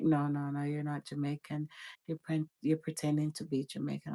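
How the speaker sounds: softly voiced mock disbelief; quiet dismissal. Retelling a man's doubt about her roots, softly.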